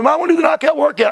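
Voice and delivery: put-on high, fast, eager little boy; boy begging his mom to join; seven-year-old kid. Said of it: "he's about seven years old".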